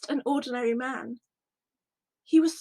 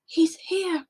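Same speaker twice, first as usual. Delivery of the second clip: gasp, then whisper it high and amazed; breathless shock of recognition; 4 semitones higher than usual. Acted. Seeing risen Jesus.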